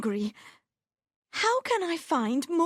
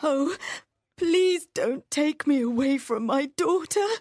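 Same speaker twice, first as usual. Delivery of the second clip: much higher, tearful plea of a mother; pleading and crying; 4 dB louder than usual. Acted. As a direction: beg not to be taken away. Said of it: "said Marianne, crying".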